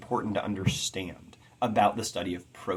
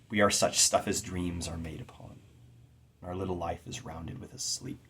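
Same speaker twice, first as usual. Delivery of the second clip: hushed recitation; 4 semitones lower, 6 dB quieter than usual. Acted. Reciting The Tempest.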